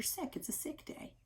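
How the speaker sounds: soft secret whisper; hushed aside to the kids. Shown as a demonstration. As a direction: breathe it like a secret. Teacher whispering to children.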